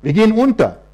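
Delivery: loud desperate cry of Herr; disciples crying out. A narrator's reading. Disciples waking Jesus in the storm, loud panicked cry. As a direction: call out to the Lord in panic. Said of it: "schrien sie".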